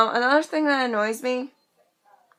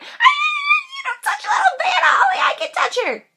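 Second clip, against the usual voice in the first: very high, loud squealed warning; mock protective outburst; 5 dB louder than usual. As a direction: shriek it in hammed-up fury. Acted outburst defending Savannah.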